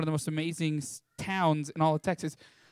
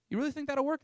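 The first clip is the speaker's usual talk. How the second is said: put-on hopeful kid; 9 semitones higher, faster than usual. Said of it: "he goes".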